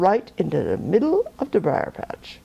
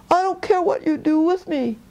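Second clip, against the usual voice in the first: higher, a put-on quaking plea from the trickster rabbit; mock trembling fear; 8 semitones higher than usual. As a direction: fake terror, pitched up and pleading. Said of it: "pretending to shake and quake all over".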